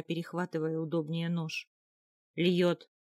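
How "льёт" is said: loud, forceful shout of a name; warning roar. A narrator's reading. Konung stopping the jarl, loud warning roar. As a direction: throw the name out loudly as a warning. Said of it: "предупреждающий рёв".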